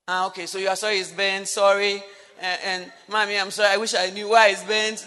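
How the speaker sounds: laughing, loud, half-hearted sorry; mock apology with laughter. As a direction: toss off a fake apology through laughter. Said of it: "he looked at me and said".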